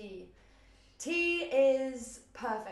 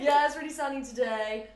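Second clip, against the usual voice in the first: laughing; 4 semitones higher, 9 dB louder than usual. Pretend. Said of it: "weather small talk joke".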